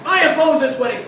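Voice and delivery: loud, high objection; objecting guest. Retold or imitated. Guest at the wedding, loudly objecting. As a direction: shout out the objection. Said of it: "some guy's like".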